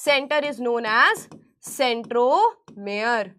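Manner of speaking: each word drawn out slowly as she writes; slow dictation. Shown as a demonstration. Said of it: "dictating a note slowly". (धीरे-धीरे बोलकर notes लिखवाना)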